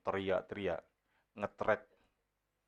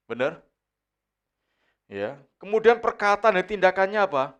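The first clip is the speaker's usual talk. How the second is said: loud, high sermon delivery; fiery preacher voice; preaching; 9 semitones higher, 11 dB louder than usual. Acted. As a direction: preach it out to the congregation, raised and forceful. Preacher at full force.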